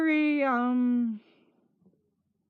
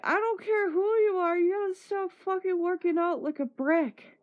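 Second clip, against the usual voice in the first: high-pitched mock telling-off; exasperated scolding; 7 semitones higher than usual. Sore from the collision, she scolds her brother in a high voice. Scolding Chris after walking into him.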